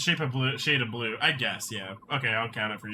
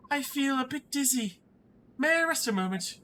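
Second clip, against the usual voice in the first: dizzy princess; 11 semitones higher than usual. Acted. Princess asking to rest a moment, high faint voice. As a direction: play a faint princess, pitched up.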